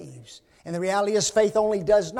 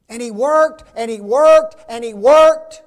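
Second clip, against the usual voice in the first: each "worked" punched much louder, building; emphasizing worked; 3 semitones higher, 13 dB louder than usual. Shown as a demonstration. Preacher hammering "worked" to drive home Noah's labor. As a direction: hammer "worked" hard, again and again. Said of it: "I'm going to emphasize that word work".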